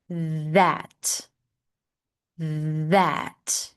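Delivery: "That" with a buzzing voiced th; "That", voice engaged on the th. Shown as a demonstration. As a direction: hum the th in "That". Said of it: "This is a voiced T H".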